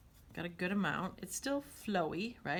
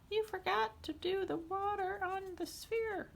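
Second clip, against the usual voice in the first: very high, slow student voice; squeaky-high put-on pupil correcting teacher; pretend student; 14 semitones higher, slower than usual. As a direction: pipe up as a pretend student, much higher. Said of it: "That was my pretend student".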